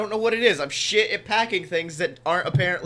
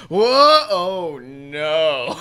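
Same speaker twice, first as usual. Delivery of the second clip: loud startled whoa, then dismay; sudden alarm; 4 semitones lower, 8 dB louder, much slower than usual. Retold or imitated. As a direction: caught off guard mid-intro. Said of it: "And then I went".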